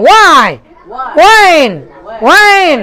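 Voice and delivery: "Why", "When" started on a plain w, no h; no h sound. Shown as a demonstration. Teacher contrasting other question words, "Why" and "When" without h. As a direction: say "Why" and "When" with a pure w, each word on its own. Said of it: "এইসের উচ্চারণ হয় না".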